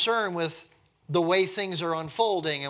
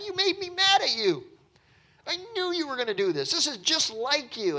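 much higher, whiny put-on voice; bitter Jonah; 11 semitones higher than usual. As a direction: pour out a pouting grievance. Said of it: "he's pouring out his heart to God".

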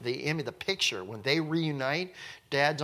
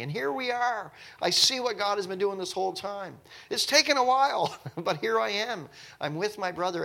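much higher, tearful joyful rush; overjoyed reunion; 8 semitones higher than usual. Retold or imitated. Joseph to himself on seeing his brother, overjoyed and high. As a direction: let the joy of finding him spill over.

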